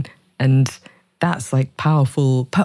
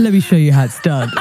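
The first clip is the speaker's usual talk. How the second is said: laughing boast; 6 semitones higher than usual. Pretend. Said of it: "playful boast, laughing".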